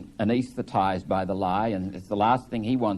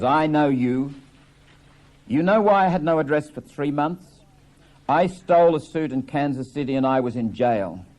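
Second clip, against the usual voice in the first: angry confession; 4 semitones higher, 5 dB louder than usual. Acted.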